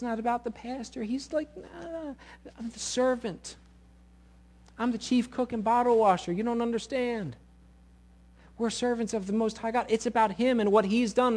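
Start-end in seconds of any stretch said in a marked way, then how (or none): 1.6-9.9 lightly acted high-pitched protest; humble demurral. Servant declining praise meant for God, mildly protesting. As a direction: wave off the credit in a raised voice.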